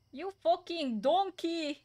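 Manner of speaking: angry British chef shout. Retold or imitated. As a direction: roar the insult like a TV chef. (lassú, hangos, dühös séf kiáltás)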